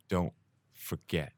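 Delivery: low, slow and very soft; quiet menace, each word measured; cold, calm warning. Retold or imitated. Imitating a passenger warning a cowed taxi driver, coldly calm. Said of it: "coldly, calmly held him".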